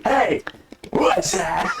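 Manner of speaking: high-pitched playful holler; mock street yell. Joking about stopping on the street, mock yell at somebody. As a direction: call out high, as if yelling down the street. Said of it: "yell at somebody".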